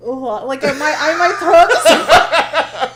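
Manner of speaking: talking while gagging; words between gags, nearly sick. Real, not acted. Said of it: "I keep gagging".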